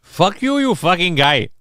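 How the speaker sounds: high-pitched comic Indian accent. Shown as a demonstration. Streamer demonstrating an accent as an angry neighbor, Indian accent. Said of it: "like, like, Indian Indian".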